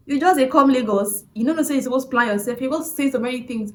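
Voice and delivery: loud, high scolding driver in Nigerian pidgin. Retold or imitated. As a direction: scold her like an impatient driver.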